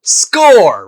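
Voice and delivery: loud, high triumphant shout; sporty friend cheering the plan. A narrator's reading. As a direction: punch the air as you yell. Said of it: "Rainbow cheered".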